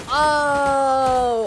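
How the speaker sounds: loud, shocked "Oh!"; dismayed gasp. Real, not acted. Streamer watches the cake carousel collapse, shocked.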